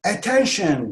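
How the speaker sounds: called-out alert; shouted warning. Shown as a demonstration. Teacher models warning someone, shouted. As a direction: call out a warning. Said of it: "diye bağırıyorsunuz".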